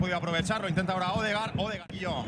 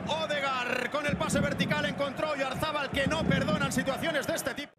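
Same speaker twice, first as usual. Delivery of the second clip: breathless goal recap; 8 semitones higher than usual. Real, not acted. Recap right after the goal call.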